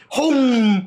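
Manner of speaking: sudden loud engine boom; loud boom sound. Retold or imitated. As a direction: burst out like a roaring engine. Engine roaring after fuel. (模仿火车轰鸣的大声)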